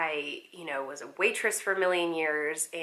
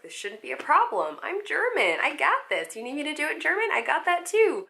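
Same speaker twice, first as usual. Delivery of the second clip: high, cocky eager voice; overconfident; 9 semitones higher, 3 dB louder than usual. Retold or imitated. Nervous actress bluffing to the casting panel, high and cocky. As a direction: brag that you've got this, pitched way up. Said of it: "I was like".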